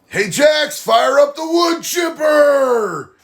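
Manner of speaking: high, slow mock battle cry; joking shout. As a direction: bellow it across the room like a war order. Calling out to Jax jokingly.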